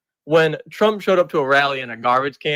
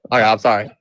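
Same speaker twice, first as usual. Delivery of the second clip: ordinary talk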